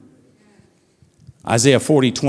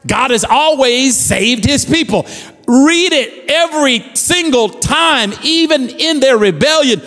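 rising scripture proclamation, building up; fervent pulpit reading; preaching; 9 semitones higher, slower than usual. Acted. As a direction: proclaim Isaiah to the church.

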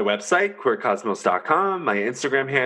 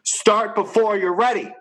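higher, slow, rallying; rally the listener; pumped up; 10 semitones higher than usual. Real, not acted. Motivational rant.

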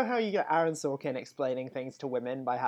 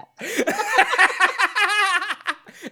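loud high laugh; 8 dB louder than usual. Real, not acted. Big laugh.

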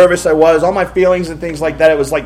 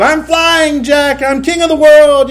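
much higher, slow dreamy swooning voice; Rose on the ship; 9 semitones higher, slower than usual. Retold or imitated. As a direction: swoon it like a movie heroine on the bow. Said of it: "Jack and Rose on the deck".

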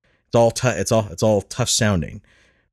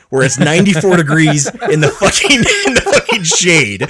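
louder, higher complaint breaking into laughter; laughing outrage; 6 semitones higher, 5 dB louder than usual. Real, not acted. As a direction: crack up while ranting in disbelief. Cracks up complaining about heat lamp.

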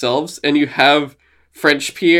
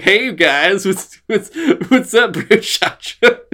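high cartoon dog impression breaking into laughter; hammed-up dude-dog greeting, laughing through it; animated dog; 6 semitones higher, 3 dB louder, slower than usual. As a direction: imitate a goofy animated dog, pitched up. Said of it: "he literally talked like this".

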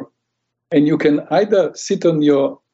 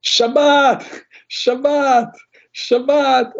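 high, far-carrying shouts of Shabbat, each falling; screaming Shabbat; 10 semitones higher, much slower than usual. Retold or imitated. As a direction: call it out over the traffic like a street preacher. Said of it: "and screams".

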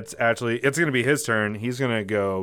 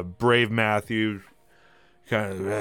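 ordinary talk, nothing marked

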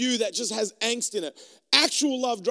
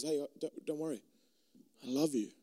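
calm reassurance; 6 semitones lower, 10 dB quieter than usual. Pretend. God voiced calmly.

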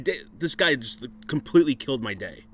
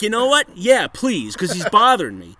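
excited; 7 semitones higher, 7 dB louder than usual. Real, not acted. Laughing, excited retelling to partner.

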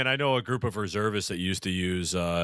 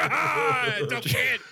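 mock fan yell; much slower than usual. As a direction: holler at the kid like an angry fan. Mock Philly fan yelling.

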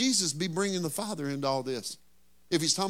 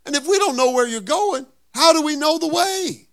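Thomas confused; 10 semitones higher, 7 dB louder than usual. Acted. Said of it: "And so Thomas says".

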